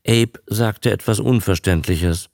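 ordinary delivery

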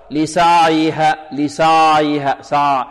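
لسعيها slowly, the ayn slack and drawn out; over-loose ayn. Shown as a demonstration. Quran teacher imitates the other mistake, over-loose ayn. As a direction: let the ع in لسعيها run on loosely, a little heavy. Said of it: "يعطيها رخاوة أكثر".

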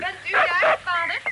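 calling out loud and high from another room. Acted. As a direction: call out to find her father. Daughter calls out to father. (roepend naar vader)

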